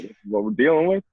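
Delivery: fast, high disbelief; mock shock. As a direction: gasp at what's coming. Freshman shocked at heat.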